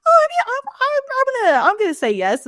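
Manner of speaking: high-pitched stammering, fumbling for an answer; flustered hedging. Retold or imitated. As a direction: squirm upward, then settle on an unconvincing answer. Roy fumbling a quiz answer.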